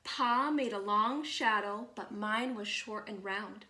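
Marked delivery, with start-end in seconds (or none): none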